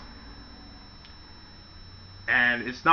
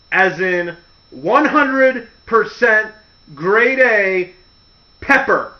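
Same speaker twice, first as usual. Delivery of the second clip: slow, louder sinister spelling out; slow, ominous reveal; slow emphatic reveal; 3 semitones higher, 4 dB louder, slower than usual. Pretend. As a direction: drag out the grim punchline. Boss man spelling out the twist.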